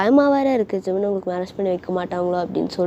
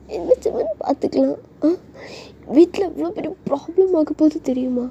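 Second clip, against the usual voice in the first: put-on high-pitched, fretful girl voice; acted girlish worry, pitched up; worried girlfriend; 7 semitones higher than usual. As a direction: protest anxiously in a high voice. Girl anxiously argues with boyfriend.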